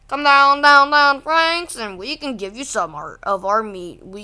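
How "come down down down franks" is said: calling out. Pretend. Calling down a character.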